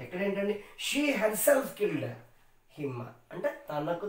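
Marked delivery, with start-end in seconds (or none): 0.9-2.1 herself said high and drawn out; slow emphatic herself; stress on herself. Shown as a demonstration. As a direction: lean on herself slowly. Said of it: "దీన్ని stress చేస్తున్నాము".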